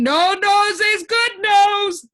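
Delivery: very high, loud, slow shout; thundering shout. A narrator's reading. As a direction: thunder it out with glee. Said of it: "thundered the din once again".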